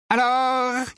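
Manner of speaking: high-pitched, demanding uncle; impatient uncle. A narrator's reading. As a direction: demand an answer in a high character voice. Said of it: "dit l'oncle Vernon, rappelant Harry à la réalité". (oncle impatient, voix haut perchée)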